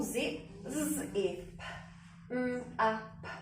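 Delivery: "z", "e", "p", "m", "a", "p" spoken as separate single sounds; each letter sound said on its own for spelling. Shown as a demonstration. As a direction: break the word into its sounds one by one. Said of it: "let's go back to that first syllable".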